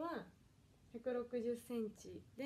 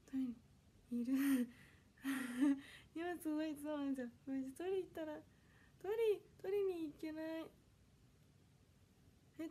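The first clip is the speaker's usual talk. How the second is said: normal talking voice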